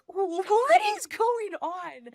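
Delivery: high, drawn-out startled question; retold bewilderment. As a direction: act out baffled alarm, pitch jumping up. Retold bewildered reaction to the screaming.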